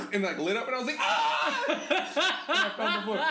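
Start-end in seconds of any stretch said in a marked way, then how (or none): 1.0-1.6 high yelp with a laugh; comic high shriek; mock terrified scream. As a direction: imitate screaming at a taser. Acts out scream at taser.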